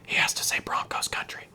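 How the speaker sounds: hushed whisper off-mic. Retold or imitated. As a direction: whisper it to the side, unvoiced. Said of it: "you can hear him whispering".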